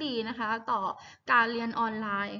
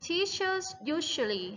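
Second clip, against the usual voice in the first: teachers usually read slowly, clearly and a bit higher; slow model reading; 5 semitones higher than usual. Shown as a demonstration. Teacher reading aloud for her class, slow and clear. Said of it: "ประโยคที่สามค่ะ".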